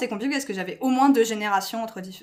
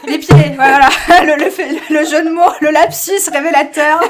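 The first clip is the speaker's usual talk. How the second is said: laughing; 7 semitones higher, 16 dB louder than usual. Real, not acted. Laughing at her own slip.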